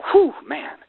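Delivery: a sudden high, excited whew of amazement; wowed by the numbers; amazed whew. Real, not acted. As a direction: let out an impressed whistle-like whew. Reacting to amazing recruiting numbers.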